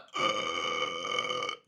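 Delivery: very low throaty croak; drawn-out creaky noise. Acted. Killer imitating a sound for the cop, low creaky croak. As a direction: croak one long imitated death noise. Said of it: "What is that".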